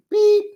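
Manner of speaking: high, loud mock bleep; imitated censor beep. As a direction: squeal it like a bleep. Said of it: "B I T".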